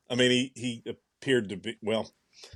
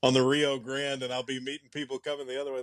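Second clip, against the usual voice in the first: ordinary talk, nothing marked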